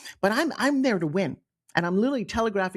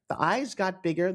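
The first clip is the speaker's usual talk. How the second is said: ordinary talk, nothing marked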